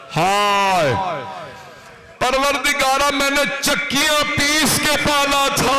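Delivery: high, lamenting delivery; keen it like a mourning chant; grieving recital of a mother's cry; mourning majlis recitation. A narrator's reading.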